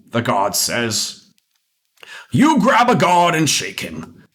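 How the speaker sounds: ordinary delivery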